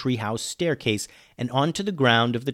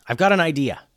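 moderately raised yell; 4 semitones higher, 5 dB louder, faster than usual. A narrator's reading. Alex calls to Ben mid-fight with a plan, yelling. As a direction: call it out urgently, louder and higher. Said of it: "yelled Alex".

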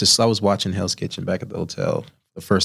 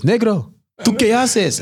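much higher, louder scolding call; bossy Senegalese chef; 13 semitones higher, 3 dB louder, slower than usual. Retold or imitated. Senior chef demanding to know what he's doing, high and sharp. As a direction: call him out sharply across the kitchen. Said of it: "She goes".

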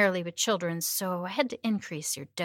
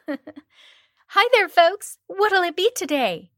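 high, loud cheery server voice; drive-thru server voice; 11 semitones higher, 12 dB louder, slower than usual. Acted. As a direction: call it like a perky drive-thru clerk. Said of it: "mimicking the voice I used when they pulled into the drive-thru".

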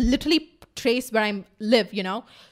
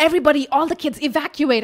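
mock alarm, raised louder and higher; alarmed kid; 4 semitones higher, 4 dB louder than usual. Kid warning friends about kidnappers, mock alarm. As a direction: sound the alarm like a panicked kid. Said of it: "he's like".

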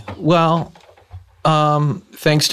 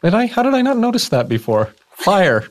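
amazed discovery; 9 semitones higher than usual. Retold or imitated. Mock discovery gasp.

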